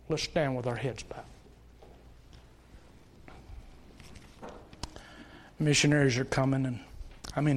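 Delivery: hushed closing invitation over quiet music; altar call. Acted. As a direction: gently plead with bowed heads.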